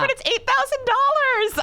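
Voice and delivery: very high, laughing plea; high mock-whiny pleading; whiny protest. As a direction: squeak it like a kid begging. Pleading over commercial pay.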